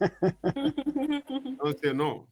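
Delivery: very slow, high pleading drawl; drawn-out plea. Acted. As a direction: stretch every word out high. Teasing plea to Adama.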